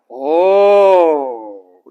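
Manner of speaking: long sing-song "Oh" swooping up and down; drawn out vowels. Shown as a demonstration. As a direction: draw the "Oh" out very long. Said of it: "they'll stretch out the vowels".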